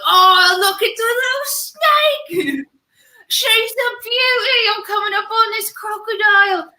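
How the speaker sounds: high, slow, excited Aussie wildlife host; Steve Irwin impression. Friends' podcast, mocking the Crocodile Hunter's excited tone. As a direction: gush like Steve Irwin over a snake. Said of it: "he's like, just always like".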